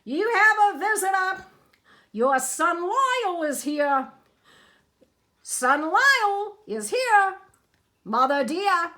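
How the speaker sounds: high, loud call across the water. A narrator's reading. Hector calling the crocodile mother across the river, loud. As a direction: call it out to the far bank.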